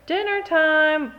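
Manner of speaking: a loud, slow dinner call; mock call. Mother calling the child in for dinner, loud and drawn out.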